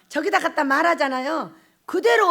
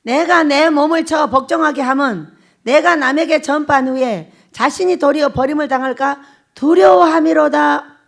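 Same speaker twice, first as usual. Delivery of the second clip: reading Scripture aloud, louder, in unison; louder, steady recitation of the verse; 7 dB louder than usual. Shown as a demonstration. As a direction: proclaim the verse to the hall like a reading. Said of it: "같이 읽습니다. 시작".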